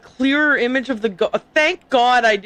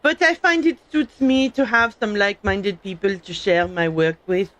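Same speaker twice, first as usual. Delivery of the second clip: terrible fake French accent; amateur mock-French character voice; Curie from Fallout Four. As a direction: put on a hammy French accent. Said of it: "Curie's voice from Fallout Four".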